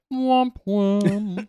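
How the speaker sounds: slow, high sad-trombone womp womp; womp womp sound effect. Shown as a demonstration. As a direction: sing the letdown womp womp slowly. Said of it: "I'm very good at sound effects".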